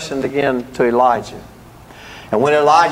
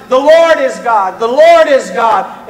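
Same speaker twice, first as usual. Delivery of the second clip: loud, higher proclamation; crowd's shout; 6 semitones higher, 5 dB louder, slower than usual. Retold or imitated. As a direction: cry it out like a jubilant crowd. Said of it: "The people shouted".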